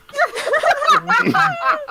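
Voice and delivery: high wheezing laugh; helpless loud laughter; laughing through pain. Real, not acted. Laughing at horrendous voice acting.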